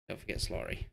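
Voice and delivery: whispered reminder to himself; whispering. Shown as a demonstration. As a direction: breathe it out in a whisper. Repeating a player's name to remember.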